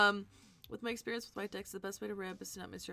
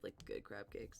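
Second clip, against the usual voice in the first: softer, wistful whisper; 12 semitones lower, 10 dB quieter than usual. Real, not acted. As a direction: breathe it out longingly. Wistful aside about food.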